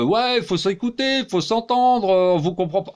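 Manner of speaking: high, slow mocking whine; mocking them. Mocking people who all talk at once, in a high whine. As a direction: parody the crowd's empty pleading. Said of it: "ils disent tous".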